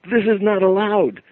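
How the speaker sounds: high, loud indignant squeal; a startled imp protesting; panicked little creature; alarmed creature. Retold or imitated. Creature protests being seen.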